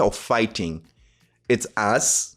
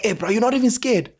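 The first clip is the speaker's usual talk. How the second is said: amazed; 9 semitones higher, faster than usual. Retold or imitated. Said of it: "these guys are shocked, they're amazed".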